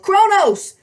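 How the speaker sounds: put-on high, loud young warrior's cry; scared battle yell. A narrator's reading. Half-dressed kid draws his sword and charges, yelling. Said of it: "He drew his sword and yelled".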